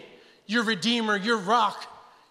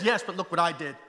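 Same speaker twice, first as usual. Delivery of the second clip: lightly voiced Christ. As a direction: answer the accuser with calm authority. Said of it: "And he says".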